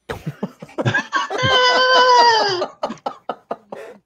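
big shrieking laugh; high burst of laughter; high loud laugh. Real, not acted. Laughing at own joke.

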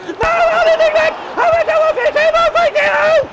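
high panicked yell with laughter; panicked shout. Real, not acted. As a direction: scream in panic. Panic at a shot on his goal.

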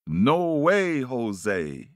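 swooping refusal, loud then falling away; emphatic refusal. Acted. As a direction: refuse with a big rise and fall. Grass refuses the bird's plea.

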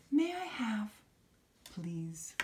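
"please" faded to a hush; a gentle, polite request; kind asking. Shown as a demonstration. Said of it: "you're asking very kindly".

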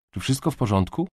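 a touch of concern. A narrator's reading. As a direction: check gently whether someone is all right. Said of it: "zaniepokoiła się Kamil".

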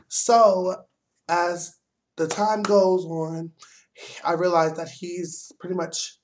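ordinary talk

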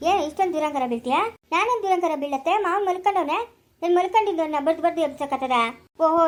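ordinary delivery

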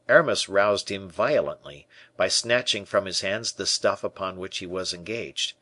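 usual speaking voice

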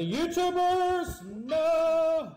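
sudden high yelp of despair; frustrated shout. Real, not acted. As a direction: cry out as the climb fails. Rage gameplay, sudden fall.

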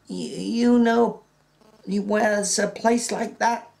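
put-on high, slow, stammering old man; hesitant old Candy. Old Candy asking George about the place, halting.

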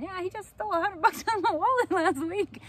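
high, loud, laughing sarcasm; sarcastic inner thought. Retold or imitated. Mom thinking about the stolen money, laughing sarcasm. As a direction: wink at the irony with a laugh.

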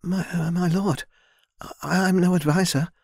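stammering. A narrator's reading. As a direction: stumble on it, caught off guard. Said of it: "she stammered".

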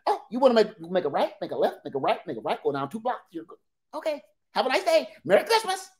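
quick cheery directions ending high; sing-song breezy local; mock chipper local, rising to a high Merry Christmas.

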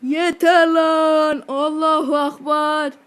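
slow, loud, drawn-out war cry; mock battle cry. Gamer switching rage mode on, loud slow battle cry. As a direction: roar it out like a charge.

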